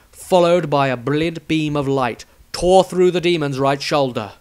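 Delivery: usual speaking voice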